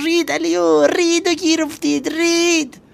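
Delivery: very high, loud despairing yell; frantic shout. Acted. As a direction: scream that it's blown. کف دستش را بر پیشانیش کوبید. (ایوب با فریاد بلند و ناامید)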